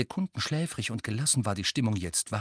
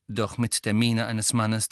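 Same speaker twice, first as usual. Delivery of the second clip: plain reading voice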